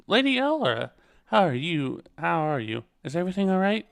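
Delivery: voicing a lady. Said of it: "I was doing a voice for her".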